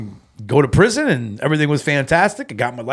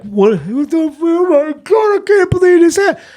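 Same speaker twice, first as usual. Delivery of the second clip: high-pitched wailing cry, loud by the end; mock bawling; 6 dB louder than usual. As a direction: act out bawling in overwhelmed disbelief. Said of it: "I was freaking bawling".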